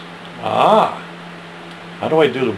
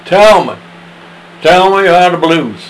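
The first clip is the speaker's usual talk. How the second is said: higher and louder asking voice; mildly voiced curious asker; eager questioner; 7 semitones higher, 7 dB louder than usual. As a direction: demand an answer.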